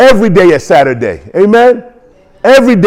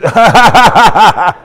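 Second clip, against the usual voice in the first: hearty laugh; 3 semitones higher than usual. Real, not acted. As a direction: let a long laugh roll out. Laughs while urging excitement.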